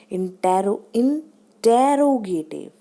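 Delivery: Interrogative slowly, leaning hard on the middle tarot; stressed syllable demo. Shown as a demonstration. Teacher showing where the stress falls, slow emphatic middle syllable. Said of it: "यहाँ पे जोर हम र पे दे रहे हैं".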